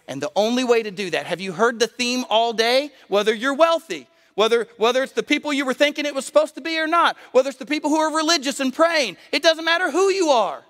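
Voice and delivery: high, forceful sermon climax, building in pitch and volume; fervent preaching. Real, not acted. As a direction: drive it home to the whole congregation, rising to a peak.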